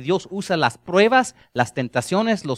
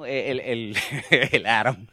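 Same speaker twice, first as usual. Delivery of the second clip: laughing; 7 semitones lower, 4 dB quieter than usual. Real, not acted. Stumbling after the pronunciation mix-up.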